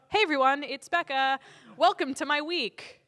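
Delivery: high, loud, slow vlogger intro; chirpy clickbait video host greeting; YouTuber voice. Retold or imitated. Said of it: "the YouTuber voice".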